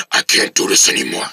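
very low angry growl. Acted. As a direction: sink into a monstrous, furious growl. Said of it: "Oh my gosh, your voice".

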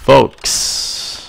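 whispered "Folks"; breathy waiter tag. Shown as a demonstration.

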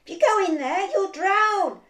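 urgent scolding. Acted. Friends warning Peter not to leave the boat, urgent scolding. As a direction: talk him out of it, loud and insistent.

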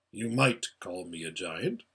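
low, measured giant-like voice; big, deep, quiet creature; large voice. A narrator's reading. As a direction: speak deep and unhurried, softly powerful. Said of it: "said the large voice".

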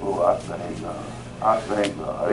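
low, soft aside of praise; hushed thanksgiving. Real, not acted. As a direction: drop low, thanking God to yourself. Private thanks after reading.

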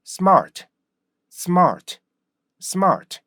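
smart as one springy falling beat with an r; native English pronunciation. Shown as a demonstration. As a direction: say smart like a native, R clearly heard. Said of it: "원어민이 발음하는". (원어민처럼 리듬 있게 smart)

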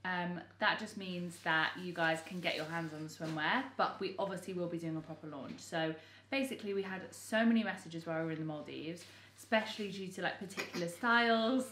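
ordinary talk

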